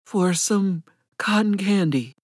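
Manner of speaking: slow, lightly ragged vagrant's plea. A narrator's reading. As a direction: draw it out like a worn-out beggar. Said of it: "His voice was as ragged as his appearance".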